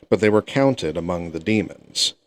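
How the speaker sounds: ordinary delivery, nothing marked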